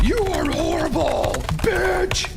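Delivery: high, slow and loud mock scolding; mock outraged insult. Japanese online crowd insulting the cast member, slow, high mock outrage. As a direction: imitate the online mob lashing out. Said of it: "Japanese people are just like".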